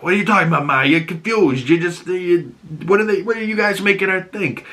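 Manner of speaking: defensive son. Retold or imitated. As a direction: Oz dismissing his mother as confused. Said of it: "he's like".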